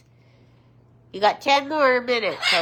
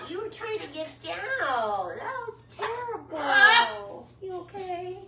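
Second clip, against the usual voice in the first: sympathetic pet talk; 10 semitones higher than usual. Real, not acted. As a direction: coo with drawn-out sympathy. Comforting a pet that fell.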